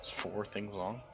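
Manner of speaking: ordinary talk, nothing marked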